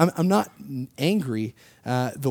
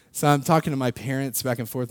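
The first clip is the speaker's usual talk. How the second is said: ordinary talk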